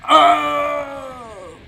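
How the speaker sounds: high, loud hammy death cry; death scream. Acted. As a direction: yell out a dying scream. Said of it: "the worst death scream I have probably ever made".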